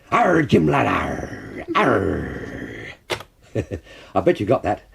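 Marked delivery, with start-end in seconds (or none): 0.1-2.9 growled pirate voice with long arrs; Long John Silver. Acted. Quiz host gives a clue as a growling pirate. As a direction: roll out a hammy pirate growl. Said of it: "the character Long John Silver".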